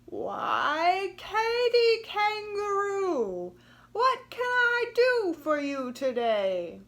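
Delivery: high, loud, beaming crocodile voice; a big, friendly crocodile greeting with a huge grin; smiling crocodile. Acted. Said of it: "opened her enormous mouth and smiled".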